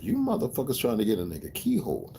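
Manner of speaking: retold incredulous reaction. Speaker retells his refusal to the group, incredulous.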